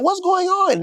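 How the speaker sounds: a woman jolted awake asking what's happening; Dime's startled question. Retold or imitated. Dime woken by the screaming, high alarmed question. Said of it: "Dime like".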